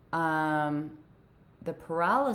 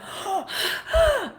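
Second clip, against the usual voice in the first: imitated gasp; 4 dB louder than usual. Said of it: "He's like".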